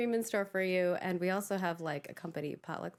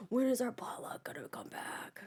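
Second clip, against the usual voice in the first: whispered plea; 2 semitones higher, 4 dB quieter than usual. Retold or imitated. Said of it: "spamming me going".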